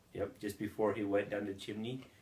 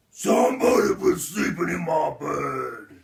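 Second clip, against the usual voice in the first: loud, booming father bear; shouted, indignant bear roar; Papa Bear; 4 semitones higher, 10 dB louder than usual. A narrator's reading. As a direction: bellow it like the big bear. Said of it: "Papa Bear walks into his room and says".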